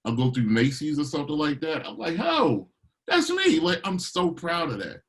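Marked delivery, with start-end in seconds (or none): 2.2-3.6 excited surprise. Retold or imitated. Hearing his song in Macy's.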